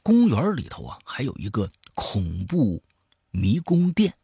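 lively storyteller swinging between hushed and emphatic; spoken horror-story narration; dramatic storytelling. A narrator's reading. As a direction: tell a creepy tale with punched key words. Tells a horror story aloud.

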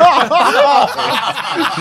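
high cackling laugh that breaks out loud; burst of laughter. Real, not acted. Telling friends about the sworn brothers, loud laugh. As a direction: laugh hard at your own story.

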